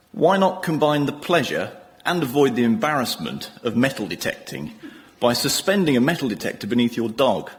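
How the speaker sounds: ordinary talk, nothing marked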